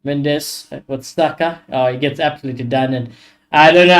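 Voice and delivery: ordinary talk, nothing marked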